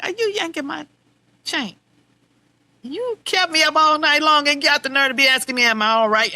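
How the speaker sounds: mock outrage at being kept awake; indignant retort. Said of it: "I'm like".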